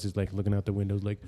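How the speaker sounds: usual speaking voice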